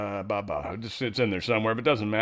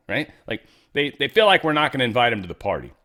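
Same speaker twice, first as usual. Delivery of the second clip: usual speaking voice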